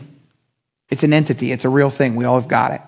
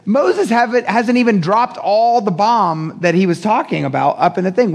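usual speaking voice

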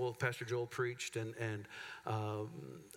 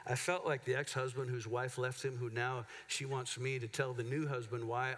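ordinary talk

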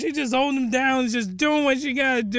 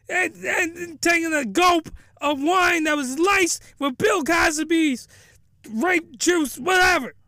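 outraged rant; 7 semitones higher than usual. Real, not acted. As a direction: yell in disbelief on his behalf.

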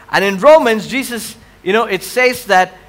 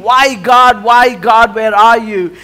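louder, insistent plea to God; pleading question; 3 semitones higher, 6 dB louder than usual. Acted. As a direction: cry it out as a believer questioning God. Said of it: "you're still asking".

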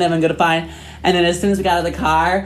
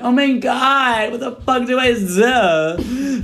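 high, drawn-out distressed whine; hammed-up high-pitched panic; mock despair; 7 semitones higher, much slower than usual. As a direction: wail it helplessly, stretching the words. Retelling despair at refused payment.

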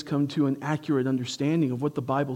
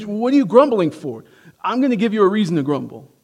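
higher, louder exasperated parent; mock scolding parent; 6 semitones higher, 5 dB louder, faster than usual. Preacher voicing Jesus as a scolding parent, mock. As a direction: throw it back like a fed-up parent. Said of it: "It's like".

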